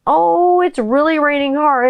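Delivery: high, mock-whiny child; whiny kid voice. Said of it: "My kids are, like, more like".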